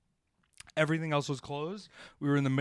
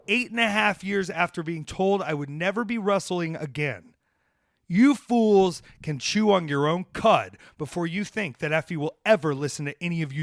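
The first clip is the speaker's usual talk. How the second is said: raised pitch and volume, defiant rant; fired up; 4 semitones higher, 6 dB louder than usual. Real, not acted. Wrestler answering those who said he'd never wrestle again, fired up. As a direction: rail at the doubters with real heat. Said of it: "see, I'm getting fired up".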